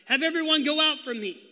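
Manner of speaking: high, loud command to clear the room; loud cry. A narrator's reading. Joseph ordering everyone out before revealing himself, a loud cry. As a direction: cry out, overwhelmed. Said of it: "and he cried".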